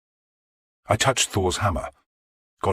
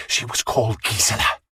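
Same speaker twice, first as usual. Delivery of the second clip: hissed; 2 semitones higher than usual. Acted. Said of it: "Godwin had hissed".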